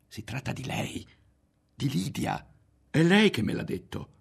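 from hushed to high and loud; building revelation. A narrator's reading. Berto revealing who told him, building in intensity. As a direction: start breathy, build to an outburst.

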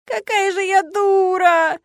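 high, loud, very drawn-out wail; bawling, every vowel stretched; wailing howl. A narrator's reading. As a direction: howl it like a sobbing girl. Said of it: "что реветь и подвывать".

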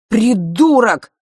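loud, high, slow insult; furious yell. A narrator's reading. Разъярённый брат кричит на младшего. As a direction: spit it out in fury. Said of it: "кричал разъярённый Норт".

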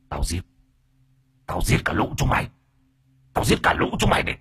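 rasping, whispery vengeful spirit voice; slurred possessed hiss. A narrator's reading. Possessed woman threatening the man who wrecked her house, slurred hissing menace. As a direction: hiss threats through a foaming mouth. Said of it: "chị nói bằng cái thứ giọng nhựa nhựa".

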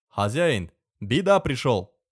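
excited Tatar servant, broken Russian; Tatar accent. Acted. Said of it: "голос татарина Касима".

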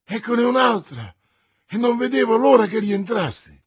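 high, loud flustered rush; bursting with news; agitated servant. A narrator's reading. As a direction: servant unloads his news on his master. Planchet beside himself.

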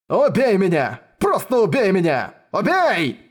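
desperate shout. Acted. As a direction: scream it out in despair. Despairing rival demands death.

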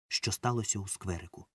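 plain reading voice

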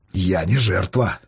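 low, slow statement; deep-pitched, deliberate assertion; firm declaration. Acted. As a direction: declare it quietly, with weight. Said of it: "заявил Рома".

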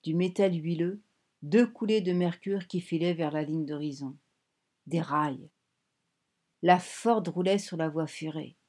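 ordinary delivery

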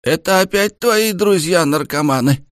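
high cranky granny calling; old woman voice. A narrator's reading. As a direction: scold like a suspicious old grandmother. Grandmother calling from next room.

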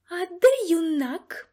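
loud, high, slow menacing question; indignant madam's threatening voice; menacing demand. A narrator's reading. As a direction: demand it imperiously, drawn out. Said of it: "грізно запитала мадам Россіні".

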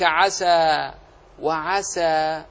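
slow, drawn-out "عسى" with a thin s; clear open sin. Shown as a demonstration. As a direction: keep s light and unemphatic in "عسى". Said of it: "خلص انفتاحها". (نطق السين مرققة منفتحة في "عسى")

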